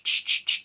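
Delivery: bright forward hissed sh; sh sounds placed bright and forward. Shown as a demonstration. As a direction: push the sh far forward in the mouth. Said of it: "I'm getting this forward sh".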